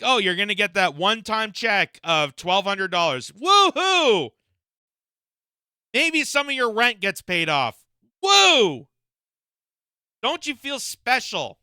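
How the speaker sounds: sarcastically hyped-up congratulations; sarcastic cheer. Acted. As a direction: gush with fake excitement, whooping over a meagre prize. Mocking a meagre one-time payout.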